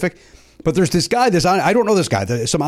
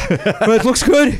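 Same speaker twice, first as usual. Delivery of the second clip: high, winded mock announcer, drawn out; gasp, then push the words out high; out of breath; 7 semitones higher, slower than usual. On-course announcer winded after running, acting out of breath. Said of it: "say I sound out of breath".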